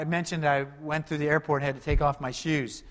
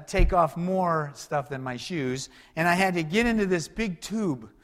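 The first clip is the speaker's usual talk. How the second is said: usual speaking voice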